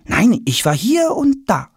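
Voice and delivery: put-on high-pitched boy's voice; hinting boy. A narrator's reading. Tom winks at father while hinting, boyish. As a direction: drop a hint in a high boyish voice.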